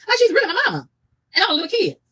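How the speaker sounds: high, loud incredulous outburst. Real, not acted. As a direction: exclaim it in high-pitched disbelief. Outraged at being scolded like a child.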